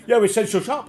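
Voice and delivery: high, loud cackling shop assistant; mock old crone voice, high and loud; imitating old crone. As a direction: play the batty old crone snapping back. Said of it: "she started going on, cackling, and she said".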